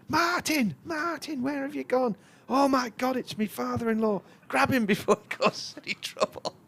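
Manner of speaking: high, loud, frantic calling out; panicked shout. Retold or imitated. Relative searching for grandad along the stand, shouting high. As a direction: call after a runaway relative in a panic. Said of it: "someone comes running along the stand shouting".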